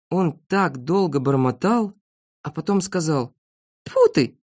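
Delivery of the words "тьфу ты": high-pitched exasperated exclamation. Acted. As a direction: spit it out in frustration. Poet gives up on rhyme. (с досадой)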